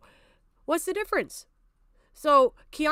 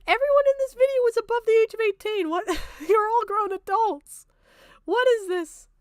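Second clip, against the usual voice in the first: much higher and louder, disbelieving; incredulous outburst; 11 semitones higher, 5 dB louder than usual. Real, not acted. Streamer reacting to the video's claims, incredulous high outburst. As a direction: shriek at how absurd it is.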